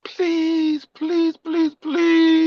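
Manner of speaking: high, soft, crying plea; begging kid. Retold or imitated. Grandpa mimicking grandson begging for a toy, high teary whine. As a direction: beg like a tearful child.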